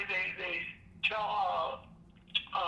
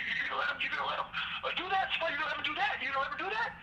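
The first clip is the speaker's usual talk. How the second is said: higher, goading repeated question; mock goading, pitched high; egging on; 6 semitones higher, much faster than usual. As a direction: needle him to act. Guard egging Spider on.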